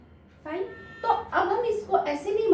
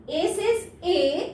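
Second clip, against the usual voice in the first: drawn-out, teacherly A says A; slow, clear phonics chant; slow phonics demo; 2 semitones higher, much slower than usual. Shown as a demonstration. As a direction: stretch each word slowly for the child. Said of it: "कैसे?".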